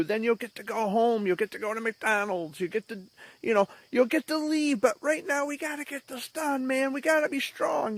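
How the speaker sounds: high-pitched, uplifting coaxing; encouraging nurse. Retold or imitated. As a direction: imitate a nurse cheering a small child on. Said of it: "they were very encouraging and uplifting".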